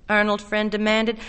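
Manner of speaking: ordinary delivery, nothing marked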